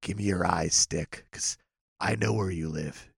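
low, soft stalker voice; heavy breathing phone whisper. Acted. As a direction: murmur the address like a creepy caller. Creepy low voice to Stick Stickly.

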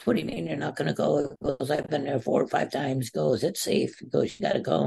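high, insistent coach retelling; yelling coach. Retold or imitated. Wrestler retells coach insisting he must go, high and pressing. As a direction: mimic the coach pushing him to go. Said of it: "he started yelling at me".

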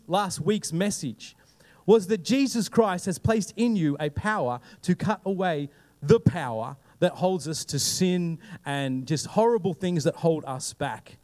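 usual speaking voice

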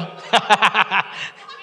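real burst of laughter; hearty laugh. Real, not acted. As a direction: laugh out at your own joke. Laughs at his own joke.

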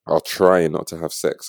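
ordinary delivery

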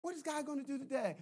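high, soft, quick question; hushed anticipation. Acted. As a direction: say it small and quick, well under the shout. Repeats the question hushed after shout.

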